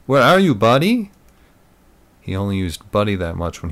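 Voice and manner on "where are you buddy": raised, louder question with a touch of nerves; nervous. A narrator's reading. Tim checks on his friend's whereabouts, nervously. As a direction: ask it high and loud, lightly nervous. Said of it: "He was nervous".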